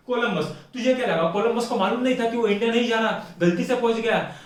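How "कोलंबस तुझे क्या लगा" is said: normal talking voice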